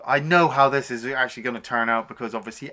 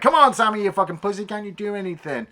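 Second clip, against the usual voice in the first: lightly acted, higher-pitched taunt; mock goading; 6 semitones higher, 3 dB louder, faster than usual. Eddie bad-mouthing Sammy over the radio, mock taunt. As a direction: goad him, starting loud and easing off. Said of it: "he just starts bad-mouthing".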